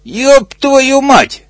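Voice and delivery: high, slow drawn-out frightened cry. Acted. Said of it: "испугался страус". (высокий протяжный испуганный возглас)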